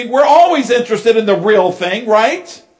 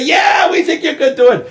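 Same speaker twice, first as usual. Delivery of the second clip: cheering crowd yelling back, pitched high; excited crowd calling out; crowd shouting; 6 semitones higher than usual. Retold or imitated. Crowd cheering the tightrope walker.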